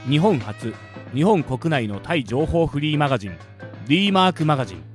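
measured announcer delivery; sponsor read. Shown as a demonstration. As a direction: read out sponsors in neat, separate phrases. Reads sponsor credits. (提供読みのアナウンス口調)

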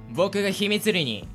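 ordinary delivery

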